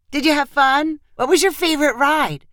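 high, keen grandma voice; grandma greeting the children; eager grandmother. A narrator's reading. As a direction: ask the grandkids eagerly, pitched well up.

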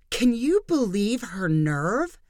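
slow, indignant hissed question; mild hiss of disbelief. Acted. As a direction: vent at her husband under breath. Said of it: "she hissed to Jeremiah".

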